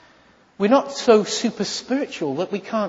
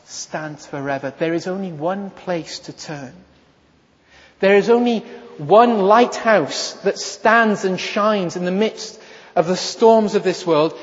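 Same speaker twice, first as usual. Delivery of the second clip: usual speaking voice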